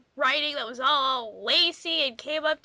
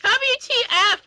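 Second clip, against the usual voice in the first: mock shout; 10 semitones higher, 6 dB louder than usual. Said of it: "I shouted".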